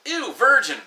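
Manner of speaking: high, loud sneering ew; mock disgust. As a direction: jeer like a schoolyard bully. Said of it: "Or it's like".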